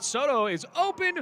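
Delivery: sudden high excited play call. Real, not acted. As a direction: lift it as the receiver breaks open. Big pass play called live.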